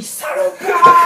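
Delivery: very high, loud drawn-out yell; screamed insult. Acted. Runner crying out in shock, not into the radio, screamed. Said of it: "je crie".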